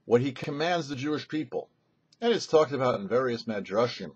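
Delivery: usual speaking voice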